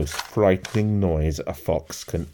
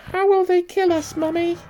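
put-on tiny, very high child's voice; small fox; 4 dB louder than usual. A narrator's reading. Small fox asking Mummy about danger, very high childlike voice. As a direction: pipe it up like a little cub. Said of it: "asked one of the small foxes".

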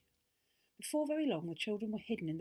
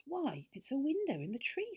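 high-pitched, wondering surprise; astonished girl in a story; 8 semitones higher than usual. A narrator's reading. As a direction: gasp out an amazing discovery. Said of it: "said Beth in astonishment".

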